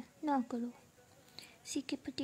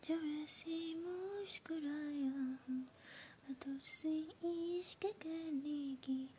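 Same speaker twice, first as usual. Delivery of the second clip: slow, soft romantic couplet, a bit higher; shayari recitation; 3 semitones higher, 6 dB quieter, slower than usual. Acted. Live stream host reciting a love couplet to listeners, slow and soft. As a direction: linger on each phrase like reciting poetry.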